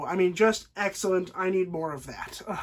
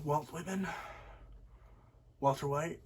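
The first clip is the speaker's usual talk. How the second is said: hushed realization; 5 semitones lower, 13 dB quieter than usual. Retold or imitated. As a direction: let the name sink in under your breath. Hank's hushed realization retold.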